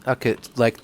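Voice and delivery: ordinary talk, nothing marked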